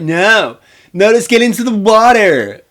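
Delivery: high, loud imitation of an overruling friend; mock bossy yell. Max overruling the others, mock bossy yell. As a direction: holler a hammed-up objection.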